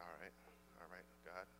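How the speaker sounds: very soft, low reply to God; quiet retold prayer. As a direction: answer God under your breath. Retold reluctant answer to God.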